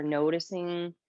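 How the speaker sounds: calm yoga teacher voice with long pauses; guided meditation. Shown as a demonstration. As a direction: ease them into stillness, leaving space between phrases. Leads breathing meditation in yoga class.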